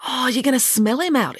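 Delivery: high-pitched exclamation; sudden high-voiced realisation; exclaiming. A narrator's reading. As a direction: exclaim it high as the penny drops. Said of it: "exclaimed Derek".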